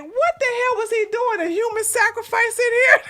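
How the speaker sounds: high-pitched disbelief; squeaky mock outrage; incredulous reaction. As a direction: imitate yourself baffled, about to laugh.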